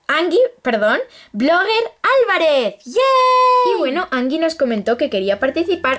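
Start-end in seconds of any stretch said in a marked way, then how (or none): 0.1-2.8 drawn-out suspense, then rising higher; hyped giveaway announcement. Real, not acted. 2.9-3.7 high, loud squeal of joy; excited cheer. Real, not acted. Cheering the winner.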